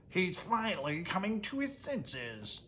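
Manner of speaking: gleeful imp. Acted. Grouch gloats.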